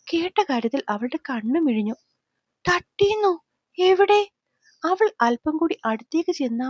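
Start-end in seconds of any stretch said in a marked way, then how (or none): 2.6-4.2 higher-pitched, startled questioning; alarmed surprise. A narrator's reading. Girl learning he got hit, alarmed surprise. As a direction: demand to know, shocked and worried.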